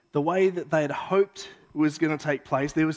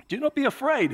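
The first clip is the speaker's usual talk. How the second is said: angel voice; 9 semitones higher than usual. Acted.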